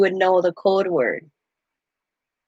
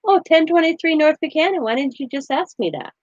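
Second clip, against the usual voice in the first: higher-pitched imitation of a kid; child answering; 7 semitones higher than usual. Son answering the officer's address question, imitated in a higher child's voice.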